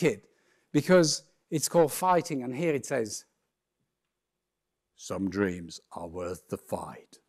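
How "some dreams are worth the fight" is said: much lower, slow American trailer voice; deep, drawn-out American movie-trailer read; slow, low movie trailer narrator in an American accent. Shown as a demonstration. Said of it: "American accented deep voice".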